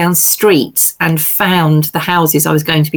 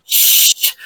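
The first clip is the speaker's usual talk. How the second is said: shushing. Retold or imitated. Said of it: "And I said".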